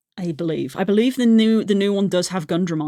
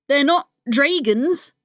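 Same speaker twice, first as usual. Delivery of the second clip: ordinary talk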